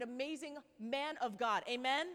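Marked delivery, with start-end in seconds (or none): none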